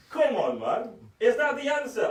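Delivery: ordinary delivery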